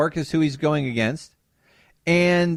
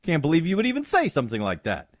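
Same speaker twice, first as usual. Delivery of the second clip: ordinary talk, nothing marked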